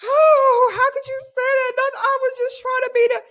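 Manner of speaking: high, whiny put-on sob voice; fake crying mother. Mother fake-crying to her child, high and whiny. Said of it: "she'll cry".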